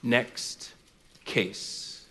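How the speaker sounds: low, very soft dismissal with a pause between words; dismissive judge. Acted. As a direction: wave her away quietly, as the judge. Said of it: "that judge would look right past her".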